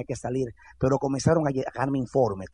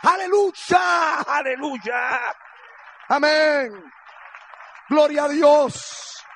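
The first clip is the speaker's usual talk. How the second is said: long, high shouted hallelujahs; ecstatic praise; 12 semitones higher, 4 dB louder, much slower than usual. Real, not acted. Preacher to congregation, shouting slow high praise. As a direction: cry out praise, drawn out and loud.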